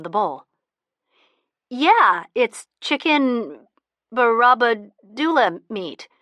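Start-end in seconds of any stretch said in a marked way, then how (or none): 1.7-6.1 fumbling lie. Acted. Elisa making up a lie.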